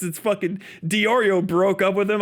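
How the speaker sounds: ordinary talk, nothing marked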